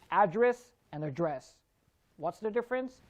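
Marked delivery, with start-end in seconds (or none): 0.1-1.5 AD-dress, then ad-DRESS; "address" stressed both ways in turn; contrasting syllable stress. Shown as a demonstration. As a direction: stress first "Address" early, second "address" late. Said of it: "Again, syllable stress".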